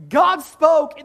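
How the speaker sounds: laughs out loud as he says it; bursting into laughter. Real, not acted. Preacher cracks up at line.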